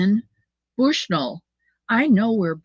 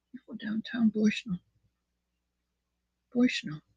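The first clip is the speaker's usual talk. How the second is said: whispered aside, breathy and hushed; 8 dB quieter than usual. Acted. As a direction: lean in and whisper the town name. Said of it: "Or maybe I'm".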